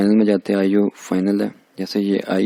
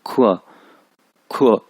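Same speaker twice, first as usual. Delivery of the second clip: खुआ with a quick falling tone; short falling खुआ; fourth tone; slower than usual. Shown as a demonstration. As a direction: drop sharply through खुआ. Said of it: "इस पे four टू ना".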